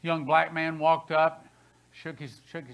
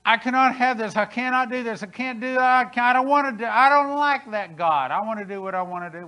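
whiny rebel; 6 semitones higher, 9 dB louder than usual. Retold or imitated. Mimicking the rebellious complainer again.

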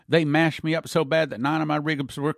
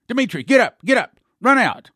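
urgent call; 8 semitones higher, 7 dB louder than usual. Retold or imitated. Angel commanding.

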